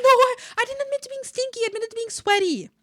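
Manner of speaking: high-pitched, put-on protest; mock indignant protest. Indignant mock correction, kept high.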